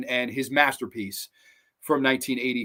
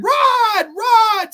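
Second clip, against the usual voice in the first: high, loud screams for help; imitated screaming; 9 dB louder than usual. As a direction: scream the name as the victim. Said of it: "screaming as you're watching the cuts appear".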